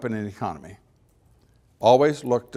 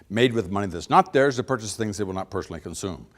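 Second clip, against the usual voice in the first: ordinary talk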